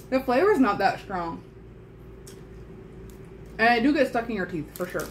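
talking around chewy candy; chewing while talking. Real, not acted. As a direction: mouth partly full. Seen 4: Left woman holding the bag chews, with closed-lip chewing between phrases.

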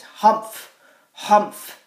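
loud gruff humph; grumpy moose humph. Shown as a demonstration. Teacher voicing the moose's loud grumpy humph for children. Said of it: "And he says".

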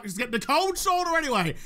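ordinary talk, nothing marked

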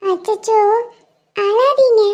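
put-on a little girl worried for her crying friend. Little girl comforting crying boy.

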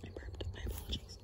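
soft whispered apology. Real, not acted. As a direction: barely breathe it. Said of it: "I gotta be quiet".

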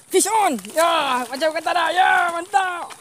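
loud high cheering as the fish bites; excited shout. Real, not acted. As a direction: yell it out, thrilled. (jeritan gembira)

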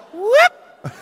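high comic "whoop" yelp; retreating whoop. Retold or imitated. Storyteller backing down from a bigger man, comic high whoop. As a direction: squeak out a quick scared whoop. Acting out his scared retreat.

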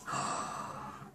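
a quiet, breathy gasp of delight; soft gasp. Real, not acted. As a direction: catch your breath at something lovely. Opening a card, delighted gasp.

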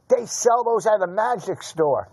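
retold yelling. Losing blackjack player accusing the dealer, retold mock yell. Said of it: "And he was yelling".

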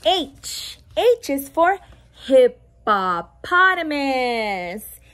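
usual speaking voice

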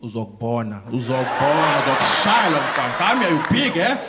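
put-on low Zulu threats rising into an accented scolding; angry Zulu accent. Angry parent threatens the boy, accent turning Zulu. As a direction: switch into a heavy Zulu accent. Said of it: "Even the accent changes".

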